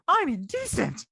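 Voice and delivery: hammed-up ride line; character quote voice.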